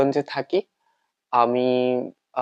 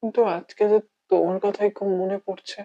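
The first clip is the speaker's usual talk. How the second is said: slightly raised, halting monologue with long pauses; heartbroken lover; 8 semitones higher than usual. Acted. Lover resolving to start anew, wavering and heartbroken. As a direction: struggle to convince yourself, then let it sag.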